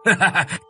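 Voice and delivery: high vengeful cackle; gloating villain laugh. Acted. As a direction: cackle in bitter triumph. Official gloats over dead wife. (cười gằn hả hê)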